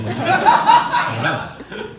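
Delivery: high, loud bewildered wail; mock bewilderment. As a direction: wail in helpless confusion. Driver's confused reply.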